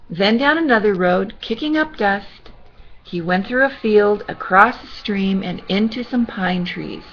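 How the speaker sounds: gentle storybook reading voice; sweet old lady. Acted. Reading to children.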